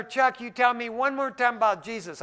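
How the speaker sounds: high, loud mock threat to brother; hammed-up warning.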